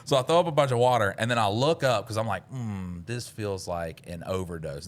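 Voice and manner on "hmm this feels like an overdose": flat, slow robot voice. Retold or imitated. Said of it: "talking like a robot".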